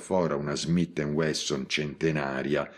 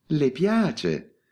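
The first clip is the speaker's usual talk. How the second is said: smiling question; 8 semitones higher, 3 dB louder than usual. Acted. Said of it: "spiò sorridente".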